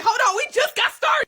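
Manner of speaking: high mock-indignant cry; incredulous protest. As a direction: yelp it in pretend outrage. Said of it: "I'm like".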